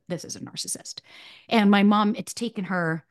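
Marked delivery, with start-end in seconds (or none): 0.1-1.0 retold therapist whispering the verdict; hushed warning. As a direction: drop to a whisper on the last words. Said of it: "And she was like".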